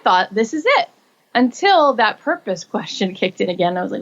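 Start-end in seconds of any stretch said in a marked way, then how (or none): 0.3-0.9 high, delighted realization; thrilled she has found her calling; triumphant. Retold or imitated. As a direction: savor the moment of arrival. Thinking she found her path.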